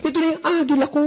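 high-pitched, overjoyed gushing; very excited. Acted. Networker thrilled about a prospect paying in tomorrow, very excited. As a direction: crow over a big win, voice way up. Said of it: "Because you're very, very excited".

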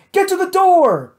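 high, loud urgent command; shouting. A narrator's reading. Hal herds everyone to the door, loud urgent shout. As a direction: drive them to the door. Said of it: "Hal was shouting".

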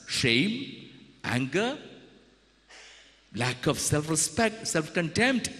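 ordinary talk, nothing marked

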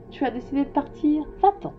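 high-pitched send-off; proud flower shooing him away; impatient dismissal. Acted. Flower sends the prince away.

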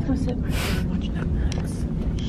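slow, soft whisper. Real, not acted. Telling someone while Lauren naps, whisper. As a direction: whisper so the sleeper isn't woken.

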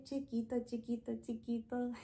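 soft, quick little repeats; small voice. Shown as a demonstration. Teacher repeating chiquito in a soft, small voice. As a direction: patter it softly. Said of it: "big and small".

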